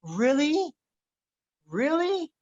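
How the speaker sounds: high rising disbelief, climbing on the second; shocked son. Retold or imitated. Son can't believe his father's answer, climbing shocked question. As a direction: play the boy's stunned disbelief. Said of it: "He was so shocked".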